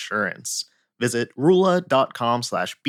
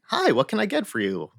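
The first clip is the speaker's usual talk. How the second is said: higher, mock machine greeting; automated greeting; 8 semitones higher than usual. Closed Wendy's machine greets the car, mock robotic. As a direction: imitate the drive-thru robot voice. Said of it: "automated thing that said something like".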